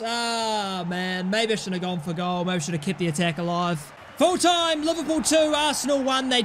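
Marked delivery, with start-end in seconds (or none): 0.0-3.9 dejected. Real, not acted. Dejected after miss.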